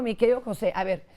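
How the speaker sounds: normal talking voice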